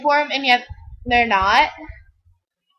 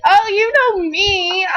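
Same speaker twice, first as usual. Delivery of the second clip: mock pleading; 7 semitones higher, 4 dB louder, slower than usual. Mistaking a stranger for friend.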